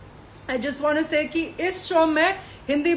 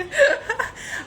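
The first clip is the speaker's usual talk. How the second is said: high giggling burst, half crying; burst of laughter; 12 semitones higher than usual. Real, not acted. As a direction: laugh it off mid-sentence. Laughing while calling Tain toxic.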